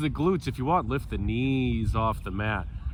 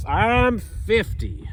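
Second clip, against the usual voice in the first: Molly Shannon voice; 8 semitones higher, much slower than usual. Acted. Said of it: "I sound like Molly Shannon".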